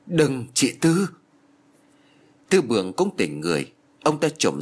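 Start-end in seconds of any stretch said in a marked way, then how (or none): 0.1-1.1 high, slow panicked plea to stop; alarmed cry. A narrator's reading. Neighbours seeing the mother raise a blade, panicked cry. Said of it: "khiến cho mọi người đều hốt hoảng".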